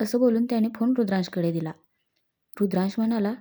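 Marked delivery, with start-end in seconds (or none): none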